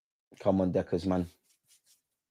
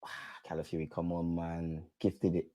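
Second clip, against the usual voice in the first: dejected groan; 8 semitones lower, 14 dB quieter than usual. Real, not acted. Groans at a mistake.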